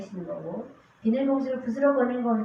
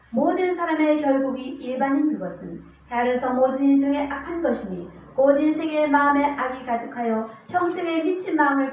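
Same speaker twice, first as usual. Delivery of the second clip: loud, emphatic Bible proclamation; scripture reading; 3 semitones higher, 6 dB louder than usual. Acted. Preacher reading the verses again to the congregation, emphatic declamation.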